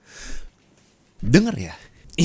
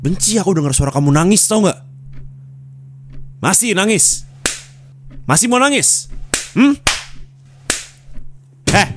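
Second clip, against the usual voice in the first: higher-pitched, menacing challenge; angry scolding; 6 semitones higher than usual. Acted. Hates hearing the other person cry, angrily challenging. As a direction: push the questions up high, daring them. Acted threat to someone crying.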